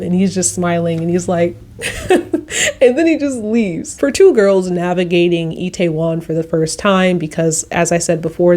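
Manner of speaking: normal talking voice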